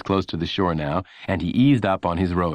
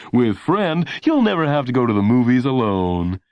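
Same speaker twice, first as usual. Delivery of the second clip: higher, louder salesy ad read; announcer voice; 4 semitones higher, 4 dB louder than usual. A narrator's reading. Announcer promising a friend to talk to, salesy. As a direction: beam it at the viewer like a pitchman. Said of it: "the announcer's voice saying".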